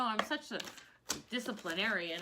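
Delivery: ordinary talk, nothing marked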